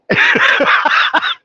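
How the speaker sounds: high breathy laugh that falls away; real laugh. Real, not acted. Recalling the crowd's reaction, a high falling laugh. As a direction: crack up at the punchline. Laughing at his own story.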